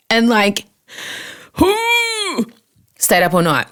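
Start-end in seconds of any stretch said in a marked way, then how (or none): 1.6-2.4 high exasperated sigh of panic; a big despairing sigh; anxious sigh. Retold or imitated. Panic set in, sweating.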